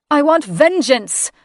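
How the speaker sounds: high, loud vengeful cry; cry for vengeance. Acted. Grieving mother demanding vengeance, high and loud. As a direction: demand it with fury.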